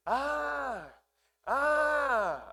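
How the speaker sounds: high wailing "ah, ah" like a newborn; imitated newborn cry. Pastor imitating his newborn son's cry for the congregation. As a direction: mimic a baby whimpering, high and loud. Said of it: "así como lloran los nenes".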